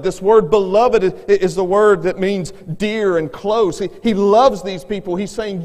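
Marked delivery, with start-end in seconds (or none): none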